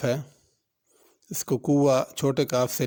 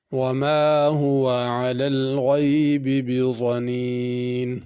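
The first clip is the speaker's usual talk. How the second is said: slow, drawn-out chanted recitation; measured melodic tilawat; Quran recitation; 3 dB louder, much slower than usual. Shown as a demonstration. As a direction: recite it like the teacher modelling tajweed. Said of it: "teacher recites verse to model tajweed".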